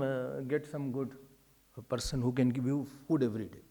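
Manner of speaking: low, soft musing as God reviews karma; lightly voiced God deliberating. Acts God assigning next births.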